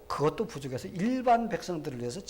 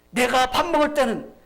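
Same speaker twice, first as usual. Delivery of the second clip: high, very loud scolding outburst; angry shout; 12 semitones higher, 13 dB louder than usual. Acted. Grandfather king scolding the prince at the table, shouting angrily. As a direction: roar it like a king silencing a child. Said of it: "하면서 화를 냈습니다".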